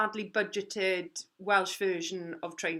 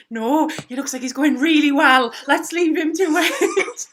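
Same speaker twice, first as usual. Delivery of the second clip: loud laughing reply; 8 semitones higher, 12 dB louder than usual. Retold or imitated. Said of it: "I was like".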